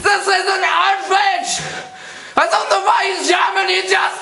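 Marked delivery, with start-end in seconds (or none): 0.0-1.6 high, hammed-up German outrage; indignant put-on German accent; outraged German man. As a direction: protest in a mock German accent. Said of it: "the German man comes on again and goes".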